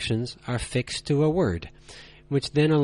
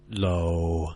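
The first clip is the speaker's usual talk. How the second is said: "low," held deep, lower and flat; low level tone; 7 semitones lower, 3 dB quieter than usual. Shown as a demonstration. Teacher showing learners the third tone, low and flat. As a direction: drop "low," to the bottom and hold it. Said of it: "They are spoken as".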